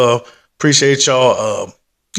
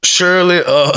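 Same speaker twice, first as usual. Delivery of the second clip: ordinary talk, nothing marked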